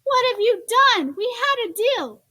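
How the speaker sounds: a mother betrayed by the deal; furious scolding. Acted. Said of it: "she screamed".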